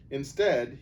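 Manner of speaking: ordinary delivery, nothing marked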